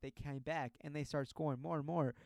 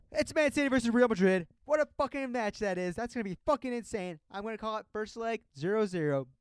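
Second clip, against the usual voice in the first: excited; 9 semitones higher, 11 dB louder than usual. Real, not acted. Hyping a prediction loudly.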